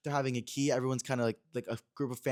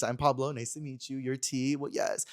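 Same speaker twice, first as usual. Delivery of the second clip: normal talking voice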